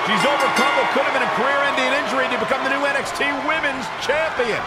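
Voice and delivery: excited play-by-play. Acted. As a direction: call the title win with building excitement. Title-winning finish called live.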